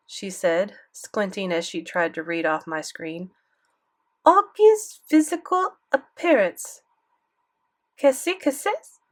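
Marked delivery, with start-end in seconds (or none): 4.2-9.0 put-on high, slow French-accented reading; French-accented grandmother. A narrator's reading. Grandmother reading grandson's screen, baffled French accent. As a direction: puzzle out the words in French accent. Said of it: "Grandmere had a thick French accent".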